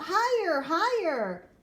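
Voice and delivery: loud, high cheering shout; friends shouting. A narrator's reading. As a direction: yell it up at the swing like excited kids. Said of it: "My friends shout".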